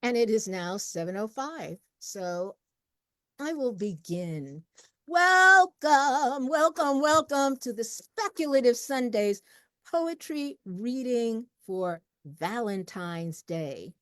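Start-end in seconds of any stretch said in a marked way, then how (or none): 5.1-13.9 big, loud, high welcome settling into slow announcement; event host's opening. Real, not acted. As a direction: ring out the greeting like a show opener.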